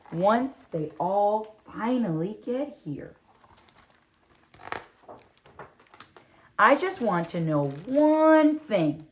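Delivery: put-on bright, lively read-aloud as a young girl telling her story; animated picture-book narration; storybook reading. A narrator's reading. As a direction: read it like a children's book to kids.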